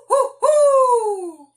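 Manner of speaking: very high, very loud hoo hoo; hoo sound. Shown as a demonstration. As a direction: call hoo hoo up high and strong. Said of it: "now I'm gonna do hoo".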